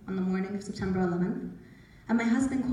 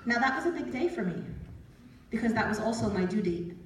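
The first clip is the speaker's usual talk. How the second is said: ordinary talk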